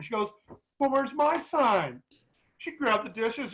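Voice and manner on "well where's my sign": tearful plea. Retold or imitated. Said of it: "she got crying".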